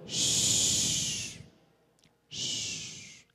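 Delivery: unvoiced hushing shh; shushing. Real, not acted. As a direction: hush the room so it goes quiet. Hushing the audience before closing.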